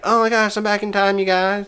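mock Cartman, high and thrilled; cartoon kid voice. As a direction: squeal it like a giddy kid lost in time. Cartman quoted again.

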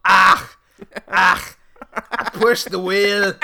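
loud strained grunts then slow Finnish-ish shout; a hammed-up Finnish strongman straining at the wheel; attempted Finnish accent. Asked to do Finnish, he strains loudly at the movie wheel in a mock Finnish accent. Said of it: "do your best Finnish".

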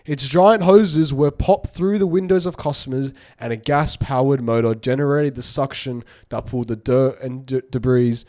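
fact reading. Acted. Reads a fact.

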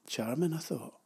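plain reading voice

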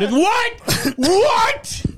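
high, stunned "What? What?"; shocked disbelief. Retold or imitated. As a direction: jump way up in disbelief.

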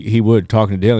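ordinary delivery

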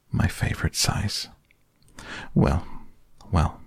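softly breathed, barely voiced; sad to himself. A narrator's reading. Eeyore muses sadly over the burst balloon. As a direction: sigh it out privately. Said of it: "he said to himself sadly".

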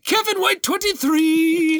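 mock announcer. Co-host's last try at a reviewer's shout-out, high mock announcer. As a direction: belt out the name in a high put-on voice.

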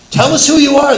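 high, loud demand. Acted. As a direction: bark the demand like an angry crowd. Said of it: "they demanded".

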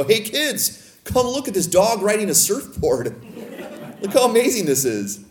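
put-on high, eager call to the kids, laughing; excited calling out. Dad calling his kids to the TV, high and excited.

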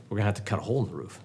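blurted quiet idea, rushed; fast, quiet plan. Acted. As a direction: rattle it off fast and low. Friend proposing cutting roof.

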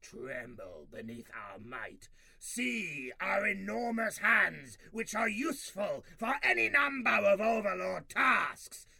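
booming alien overlord voice; a pompous tyrant proclaiming; dragon voice. Acted.

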